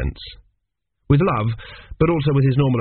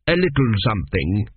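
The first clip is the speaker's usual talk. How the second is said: ordinary delivery, nothing marked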